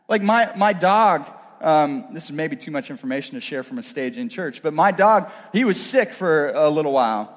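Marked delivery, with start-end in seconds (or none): none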